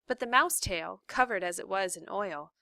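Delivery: ordinary delivery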